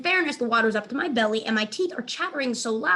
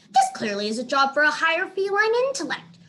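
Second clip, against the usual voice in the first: lofty, higher-pitched clever cat; haughty cat voice; 7 semitones higher, 6 dB louder than usual. Acted. A cat boasting of his higher intellect. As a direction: talk down like a superior feline.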